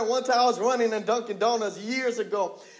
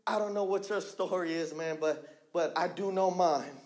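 much softer and lower, intimate; quiet personal confession; 6 semitones lower, 9 dB quieter than usual. Real, not acted. Preacher sharing his own story, soft and intimate. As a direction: pull back to a near-private voice.

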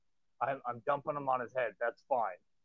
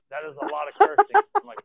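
high, loud scandalised lady voice; prim mother; 14 semitones higher, 14 dB louder than usual. Retold or imitated. Mother in the third row objects to the cursing, high and prissy. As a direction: mimic a prissy complaining parent.

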